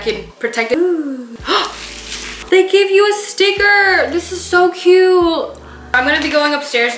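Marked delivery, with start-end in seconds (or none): 1.4-2.5 excited gasp. Real, not acted. Opening the package, finds sticker. 2.5-5.6 excited. Real, not acted. Delighted unboxing a sticker.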